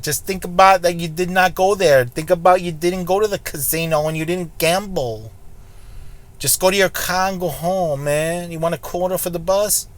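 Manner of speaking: put-on joking New York tough-guy accent, lightly done; fake New York accent. Telling Brandon to go home, in a fake New York accent. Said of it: "My fake California, New York accent".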